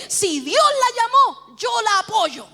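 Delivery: high, loud, slow pronouncement; solemn declaration. Retold or imitated. Father granting his support, solemn declaration. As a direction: proclaim it with full conviction.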